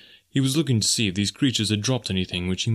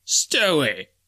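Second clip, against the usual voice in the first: surly retort. Acted. Sailor sneering back at Snipes, lightly surly. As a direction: snap back with contempt, slowing on the insult. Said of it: "retorted one of the men in a surly tone".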